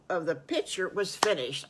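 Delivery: ordinary delivery, nothing marked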